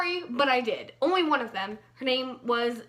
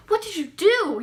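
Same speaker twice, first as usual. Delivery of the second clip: mock shocked teacher; 7 semitones higher, 8 dB louder than usual. Teachers shocked at broken toe.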